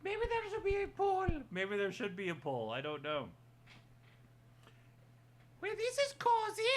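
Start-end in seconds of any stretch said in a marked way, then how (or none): 0.0-1.3 squeaky high character voice; high cartoon voice; high puppet voice. Puppet then plain repeat. 5.6-6.8 high puppet voice. Acted.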